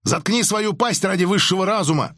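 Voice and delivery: high, angry bark; harsh barking commands. A narrator's reading. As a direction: snap back furiously. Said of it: "рявкнул он в ответ". (резко рявкает в ответ)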